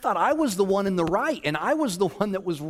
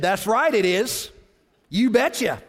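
gloating rant; 4 semitones higher, 3 dB louder than usual. Retold or imitated. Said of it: "And I'm just going off".

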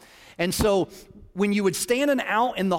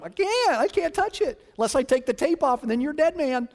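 much higher, pleading sound guy; panicked sound guy; 8 semitones higher than usual. Retold or imitated. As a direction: squeal it out like a cornered tech guy. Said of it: "that poor guy's going".

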